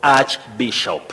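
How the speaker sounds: "archbishop" said slowly with a clear ch, as in church; pronunciation demo. Shown as a demonstration. Teacher on TV modelling a word, slow demo. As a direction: spell out the arch sound in "archbishop". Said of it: "pronounced as arch".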